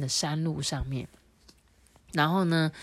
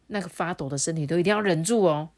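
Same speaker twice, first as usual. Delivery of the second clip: plain reading voice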